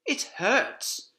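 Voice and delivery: a touch of pained fox. Acted. Injured fox telling his wife, mildly pained. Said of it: "said Mister Fox".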